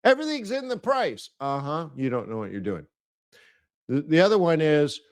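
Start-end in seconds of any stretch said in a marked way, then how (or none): 0.1-1.3 much higher, sing-song mockery; high mocking parody of a pundit; mocking cliché. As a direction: parrot the lazy market cliché. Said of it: "what do they say?".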